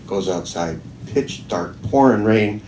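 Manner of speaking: normal talking voice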